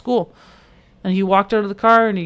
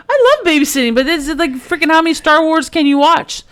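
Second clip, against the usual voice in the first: loud, high-pitched comic exasperation; exasperated outburst; 11 semitones higher, 7 dB louder than usual. Real, not acted. Former babysitter venting about endless Star Wars, loudly exasperated. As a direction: burst out high and loud, half laughing.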